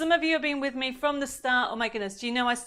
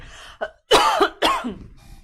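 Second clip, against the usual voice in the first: loud throat-clearing cough; real cough; 9 dB louder than usual. Real, not acted. Host excusing themself to viewers, real cough. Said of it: "I'm just gonna cough".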